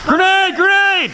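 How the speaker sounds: loud, high shout of warning; grenade warning yell. Real, not acted. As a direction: yell it out to the squad. Grenade thrown, team warning.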